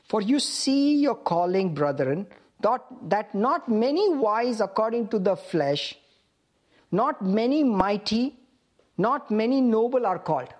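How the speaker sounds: slow, deliberate scripture reading. Real, not acted. Preacher reads the verse for listeners to follow, very slowly. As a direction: stretch the key words, pausing between phrases. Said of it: "I'm gonna read it very slowly".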